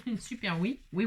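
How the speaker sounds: ordinary talk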